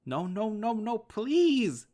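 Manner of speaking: high, loud panicked scream, stretched slow at the end; terrified child begging dad not to shoot; desperate screaming. Acted. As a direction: scream at him to stop, terrified. Dad bringing rifle up.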